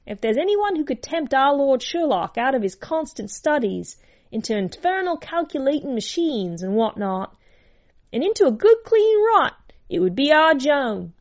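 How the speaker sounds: high, animated servant's voice; lively high-pitched cook holding forth; household cook. A narrator's reading. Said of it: "for as the cook said".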